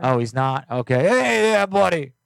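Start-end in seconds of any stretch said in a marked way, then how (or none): 1.1-2.1 high, loud hype shout; hollered catchphrase. Pretend. Host hyping up viewers, high loud holler. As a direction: holler it out to the audience.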